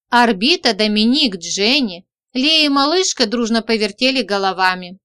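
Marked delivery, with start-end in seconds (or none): none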